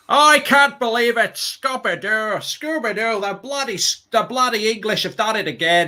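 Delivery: high, slow mock Aussie grumble; Australian accent. Mock Australian fan moaning that England won again. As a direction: drag it out like a sulking Aussie. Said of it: "That's Australian".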